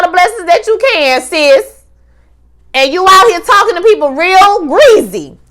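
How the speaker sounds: loud sassy scolding; heated rant. Real, not acted. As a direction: go off on a character you can't stand. Speaker ranting at Sydney.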